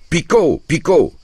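high, loud urging cry; sharp high call that falls away; shouted command. A narrator's reading. Peasant to the boy whipping the mule, shouted. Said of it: "puis me cria".

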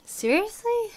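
very high, loud quick "Seriously?"; loud incredulous squeal; outraged disbelief. Real, not acted. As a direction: bark out the shock. Shocked at migrant numbers.

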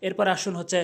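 usual speaking voice

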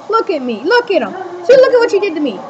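ordinary delivery

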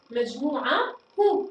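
مجموعة هو with a clear final hu; ha voiced. Shown as a demonstration. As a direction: separate هو from مجموعة. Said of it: "أصبحت واضحة". (نطق مجموعة هو بهاء واضحة)

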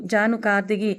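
ordinary delivery, nothing marked

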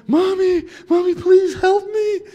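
put-on very high, loud childlike cry for mommy; yelling for mommy. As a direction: wail for mom like a scared kid. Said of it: "I began to yell for my mom".

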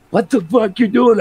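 mock buddy yell. Retelling his buddy's reaction to the sparking plow, high mock yell. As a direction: throw out the buddy's baffled shout, hammed up.

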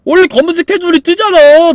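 loud shocked what; alarmed shout. Real, not acted. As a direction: shout in disbelief. Shocked at game result.

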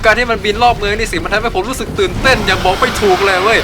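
loud, high hammed-up thrill; over-the-top excited yelling; acted excitement. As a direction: shout your wonder for the camera. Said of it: "กูเป็น acting เว้ย".

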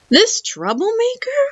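high, rising disbelief; incredulous shopkeeper. A narrator's reading. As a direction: question it with a steep upward swoop. Shopkeeper doubts the boy's request.

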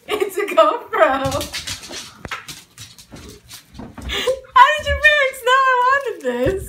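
high, loud, overwhelmed with excitement; real thrilled amazement at a present; amazed delight. Real, not acted. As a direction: voice climbs in joyful disbelief. Amazed by the gift from parents.

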